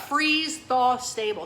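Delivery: FREEZE THAW STABLE drawn out slowly and loudly; slow, careful phrase. Shown as a demonstration. Presenter repeating a product term to the crew, slow and careful. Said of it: "Say that three times fast".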